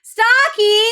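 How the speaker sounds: high, loud greeting shout; big high-pitched hello; excited call-out. Real, not acted. Calling out to a greeted viewer.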